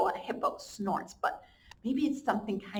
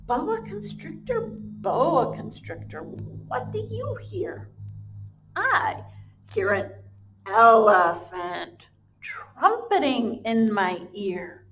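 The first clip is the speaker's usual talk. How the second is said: sing-song slow storybook read; reading to children; 6 dB louder, slower than usual. Acted. Teacher reads the refrain aloud to her class, slow and sing-song. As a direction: chant the refrain for little ones.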